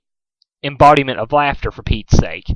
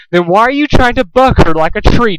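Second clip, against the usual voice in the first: menacing accusation; 9 semitones higher, 5 dB louder than usual. A narrator's reading. Pinkamena accusing.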